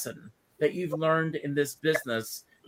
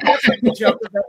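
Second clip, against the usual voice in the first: laughing; 5 semitones higher, 8 dB louder than usual. Real, not acted. Laughing reaction to joke.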